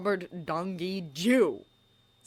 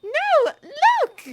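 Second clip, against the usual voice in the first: put-on high, loud girl's cry; Hermione shouting; 13 dB louder than usual. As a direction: call out high and urgent. Hermione calling out loudly.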